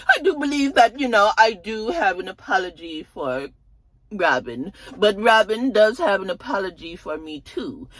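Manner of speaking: high, deliberate demand; a high, measured, self-righteous demand; prim Karen. Retold or imitated. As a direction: insist Robin owes her too. Karen demanding Robin's apology.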